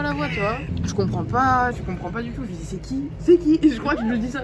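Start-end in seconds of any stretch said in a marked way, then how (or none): 2.6-3.0 puzzled question. Retold or imitated. Retelling a confusing phone call, puzzled and quiet. 3.2-3.6 higher, louder retold demand; baffled outcry. Retelling a call from an unknown voice, baffled outcry. Retelling his escalating phone reaction.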